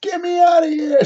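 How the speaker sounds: high, mock-strained cry; high squeal of a plea; mock desperate plea. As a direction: imitate a high-pitched plea to escape. Acting out his drunk plea.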